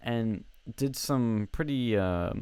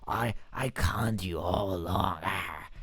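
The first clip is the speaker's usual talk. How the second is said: slow, smug mock villain voice; hammed-up villain revealing his master plan; cartoon villain. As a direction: purr it like a gloating baddie at the movie's end. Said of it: "the villain's like".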